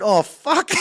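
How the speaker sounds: high, loud mock panic with a laugh; startled curse. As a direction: blurt it out, half laughing. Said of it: "I went".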